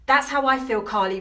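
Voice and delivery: normal talking voice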